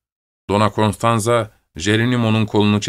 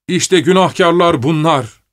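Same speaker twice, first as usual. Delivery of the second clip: higher-pitched public denunciation; narrator's raised outcry; accusing shout; 6 semitones higher, 4 dB louder than usual. A narrator's reading. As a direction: denounce them to the whole crowd. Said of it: "diye bağırınca".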